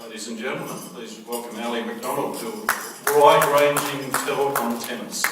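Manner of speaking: loud, welcoming announcement; announcing the guest. Real, not acted. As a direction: call the room to welcome him. Introducing the guest speaker.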